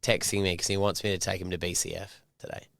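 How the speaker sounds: usual speaking voice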